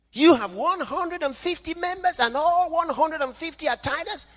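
high-pitched astonished disbelief; incredulous question. Acted. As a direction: question the pastor in amazement. Acted astonished questioner in anecdote.

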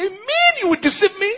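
high, indignant outburst at the tree; angry Jesus. Acted. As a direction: hurl it at the fig tree, high and sharp. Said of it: "He was angry".

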